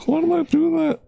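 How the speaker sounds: very high falsetto whine; squealed self-reproach. Pretend. Streamer to self after a slip, high mock squeal.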